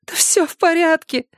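put-on high childlike reassurance; a small girl smoothing things over; little girl. A narrator's reading. Girl reassuring Erik, high childlike voice.

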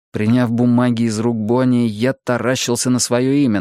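ordinary delivery

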